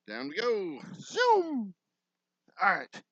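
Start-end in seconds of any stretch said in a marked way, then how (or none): 1.0-1.7 squeaky high zoom like a toy; mock zoom sound. Builder voicing the wall sliding down, a squeaky high zoom. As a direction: make the noise of something whooshing down.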